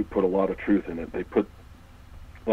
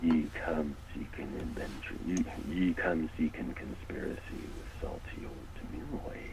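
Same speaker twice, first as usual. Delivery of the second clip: pirate voice; 2 semitones lower, 10 dB quieter than usual. Acted. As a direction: growl it like an old sea dog. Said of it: "I love your pirate impression".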